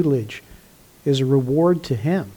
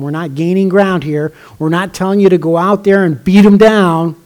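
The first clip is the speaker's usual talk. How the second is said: louder, driving pulpit emphasis; preaching at full force; 7 dB louder than usual. Acted. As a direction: preach it hard to the congregation. Preacher hammers point to congregation.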